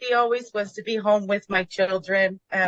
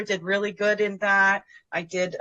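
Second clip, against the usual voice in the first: ordinary talk, nothing marked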